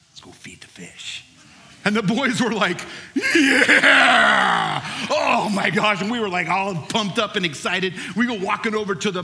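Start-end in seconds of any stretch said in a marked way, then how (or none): 0.1-1.2 low, hushed voice of the host; soft unvoiced whisper, retold; conspiratorial whisper. Host's secretive invitation in story. 3.1-6.0 put-on loud, high whoop of excited boys; big imitated cheer, easing off; boys' excited cheer. Excited boys' reaction in story.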